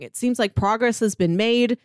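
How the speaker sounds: ordinary talk, nothing marked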